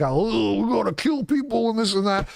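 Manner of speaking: high, fast mocking blowhard; hammed-up tough talk; mock tough guy. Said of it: "I know I sound like a tough guy".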